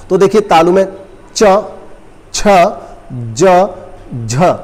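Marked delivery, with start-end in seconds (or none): 1.4-4.5 च, छ, ज, झ said slowly, each apart; slow motion consonants. Shown as a demonstration. Teacher sounding out palatal letters slowly for class. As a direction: draw out च, छ, ज, झ one at a time. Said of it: "जब आप slow motion में बोलेंगे".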